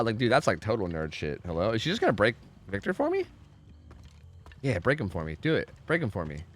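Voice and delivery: ordinary delivery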